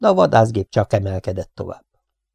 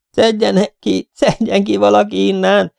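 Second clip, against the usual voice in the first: high, panicked yell; frantic high-pitched howl; screaming for help; 11 semitones higher, 3 dB louder than usual. A narrator's reading. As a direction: shout desperately to be rescued. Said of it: "üvöltötte egy hang".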